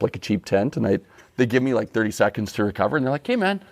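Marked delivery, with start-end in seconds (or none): 3.3-3.6 high, breezy mock staff voice. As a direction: cheerfully send the patient off. Staff after the shot.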